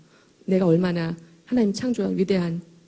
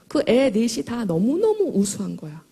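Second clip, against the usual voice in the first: usual speaking voice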